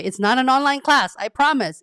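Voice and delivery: firm emphatic promise; emphatic insistence. Real, not acted. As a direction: insist hard it's no online class. Insisting it's real coaching.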